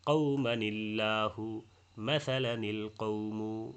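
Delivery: কওমানিল্লাহু মাসালানিল কওমু read very slowly with no long A; slow model reading without madd; alif not lengthened. Shown as a demonstration. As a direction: draw each word out slowly, skipping the alif length. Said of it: "তাহলে অর্থাৎ মদ হবে না".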